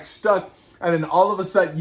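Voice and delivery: ordinary talk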